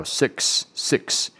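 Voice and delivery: six ending in a crisp ks; clear ks in six. Shown as a demonstration. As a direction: land the ks at the end of six. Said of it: "重点就是后面那个크스". (six结尾的ks要清楚)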